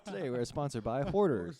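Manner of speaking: loud, high-pitched joke sponsor plug; mock ad read. Podcast host jokingly plugging a sponsor, loud mock ad read. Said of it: "Was this like an ad?".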